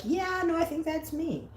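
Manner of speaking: sudden high recognition; realizing it's me. Retold or imitated. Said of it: "I'm like".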